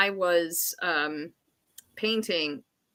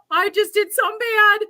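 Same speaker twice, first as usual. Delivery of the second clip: high, loud, frightened admission; panicked confession; 12 semitones higher, 6 dB louder than usual. Retold or imitated. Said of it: "I'm freaking out".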